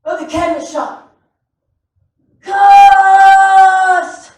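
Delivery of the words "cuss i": loud high shout of cuss. Retold or imitated. Coworker yelling from across the cabinet shop, loud and high. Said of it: "I could hear him".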